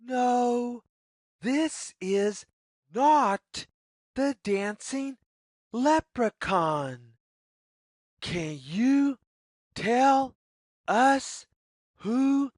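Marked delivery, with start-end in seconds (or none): none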